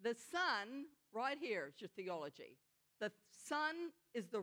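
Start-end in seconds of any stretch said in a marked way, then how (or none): none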